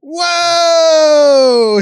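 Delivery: loud amazed whoa; excited shout. Real, not acted. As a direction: shout with delight. Unboxing a poster, excited.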